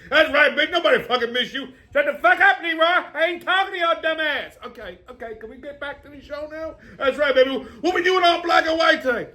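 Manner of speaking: high, hammed-up trash talk; bickering sketch characters. Leroy and Big Mama trade insults in high put-on voices. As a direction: snap back at each other, playfully rude.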